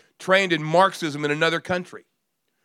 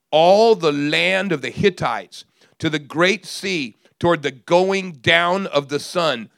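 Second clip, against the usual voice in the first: preaching scripture; 2 semitones lower, 4 dB louder than usual. Acted. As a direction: proclaim the promise over the crowd. Reads Joshua promise aloud.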